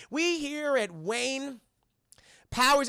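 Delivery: drawn-out, high "Wayne", then a pause before "Powers"; "Wayne" held long as if resented; grudging pause after Wayne. Retold or imitated. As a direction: stretch "Wayne" slowly, halt, then say "Powers". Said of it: "that little subtlety in his voice".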